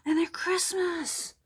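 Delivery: high, loud, slow excitement; thrilled delight. Real, not acted. Crafter finds the old stickers are Christmas ones, thrilled delight. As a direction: exclaim it slowly, thrilled at the find.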